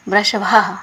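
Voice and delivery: वृषभः said slowly and clearly, every syllable sounded out; model pronunciation. Shown as a demonstration. Teacher modelling वृषभः for students to repeat. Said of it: "आप मेरे साथ शब्दों का उच्चारण कीजिए".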